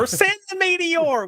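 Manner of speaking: high hillbilly holler. Acted. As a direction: yell it out, hillbilly style. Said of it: "a hillbilly accent".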